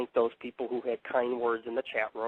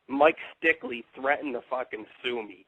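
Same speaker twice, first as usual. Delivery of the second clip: usual speaking voice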